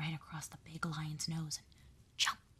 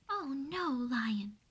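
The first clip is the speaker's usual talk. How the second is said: pleading mouse; 5 semitones higher, 5 dB louder, much slower than usual. Acted. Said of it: "pleaded the little mouse".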